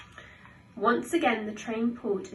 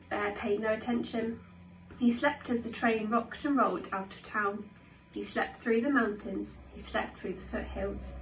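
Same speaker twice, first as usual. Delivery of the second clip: ordinary delivery, nothing marked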